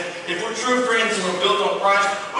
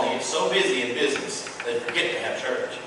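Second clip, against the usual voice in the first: quiet echo; 5 semitones lower, 5 dB quieter than usual. Shown as a demonstration. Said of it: "That's where most churches go wrong".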